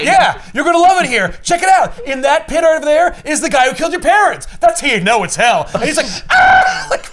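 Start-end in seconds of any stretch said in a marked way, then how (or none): 0.0-4.5 high, loud mock-cheery demon; gleeful demon host. Demon selling hell to Batman. 6.3-6.9 high, loud hammed-up scream; mock scream. Batman screaming.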